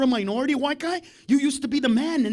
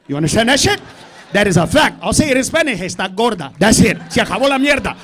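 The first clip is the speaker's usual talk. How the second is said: emphatic, raised-volume declaration; loud dad tirade; 3 semitones lower, 8 dB louder than usual. Acted. Dad telling mom the hard fact, loud and emphatic. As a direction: lay down the law loudly. Dad's lecture erupts.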